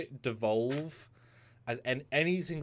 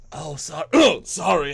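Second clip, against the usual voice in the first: louder apology; 6 semitones higher, 9 dB louder than usual. Retold or imitated.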